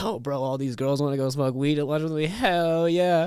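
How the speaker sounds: building, rising retold glee; excited. Replaying his reaction to the girls' invite, excited.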